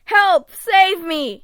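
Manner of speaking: loud, high panicked shout; desperate yell for help; crying for help. Acted. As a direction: scream for rescue from icy water. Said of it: "he cries".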